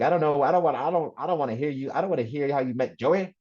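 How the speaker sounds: sing-song mock Alabama dad; father's Alabama accent. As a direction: do your Alabama father, halting and repeating himself. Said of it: "that's exactly how he talks".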